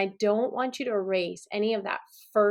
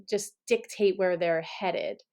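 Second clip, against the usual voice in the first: ordinary talk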